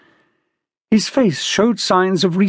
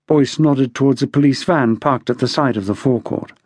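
usual speaking voice

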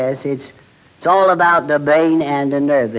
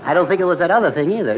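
fast, laughing; much faster than usual. Real, not acted. Embarrassed fast denial with laugh.

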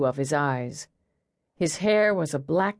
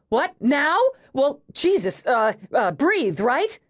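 higher, halting, panicky blurts; flustered panic; 7 semitones higher, 3 dB louder than usual. A narrator's reading. Eve thinking Mavis is in labor, flustered panic. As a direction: scramble for what to do, voice jumping up.

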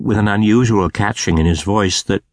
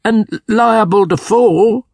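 much higher and louder, slow plea; remonstrating; 13 semitones higher, 5 dB louder, slower than usual. A narrator's reading. Scrooge pleads with the spirit not to fly, high and slow. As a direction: protest in fear of falling, high and slow. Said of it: "Scrooge remonstrated".

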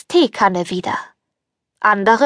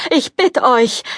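higher, desperate begging; pleading prince; 3 semitones higher than usual. Acted. Prince begs the fairy to spare him, pleading. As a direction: beg her to stop, desperately. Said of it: "flehte der Prinz".

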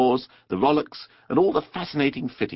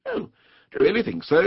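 higher-pitched, earnest question; serious inquiry; 8 semitones higher than usual. A narrator's reading. Rat questions delighted Mole, seriously. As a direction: ask it in all sincerity. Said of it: "inquired the rat seriously".

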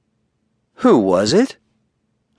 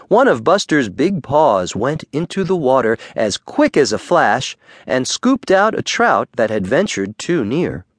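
ordinary delivery

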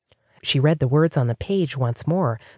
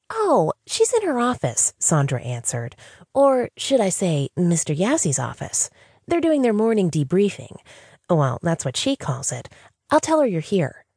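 put-on high, chirpy young receptionist voice; bubbly receptionist; 5 semitones higher than usual. Receptionist Sandra greeting the new hire, high and bubbly. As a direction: play the eager, sunny front-desk girl.

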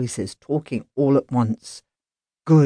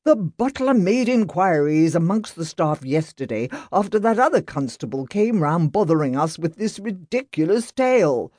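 higher, indignant master of the house; haughty rich man losing patience; irritated gentleman; 9 semitones higher than usual. Acted. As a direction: send the policeman packing. Grant's angry tirade.

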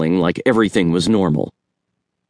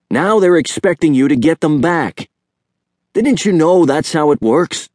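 higher, knowing uncle's voice; Uncle Jerry, higher voiced; 7 semitones higher than usual. A narrator's reading. Uncle explaining friendship, higher knowing voice. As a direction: let the nephew in on it, pitched up. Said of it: "Uncle Jerry said".

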